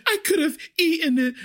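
high, tearful-sounding wail in imitation; mock freak-out. Customer horrified by a slug on her food, high mock panic. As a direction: imitate a panicking diner, pitch way up. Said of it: "But she is freaking out".

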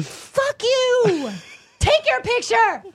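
retold high-pitched furious yell; loud, shrieked comeback in a re-enacted fight; losing it. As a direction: scream it back as a parting insult. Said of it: "I lost it".

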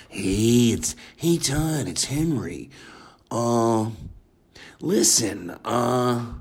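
hesitant, quiet mumbling impression; halting, hesitant voice with lots of uhs; Henry Phillips impression. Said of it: "that's my impersonation".

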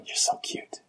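unvoiced, fond whisper; whispered "you're so cute". Teasing friends about their Canadian accent, whispered. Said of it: "and I go".

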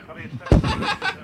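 high breathy giggle. Pretend. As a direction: burst out giggling. Pilot giggles again.

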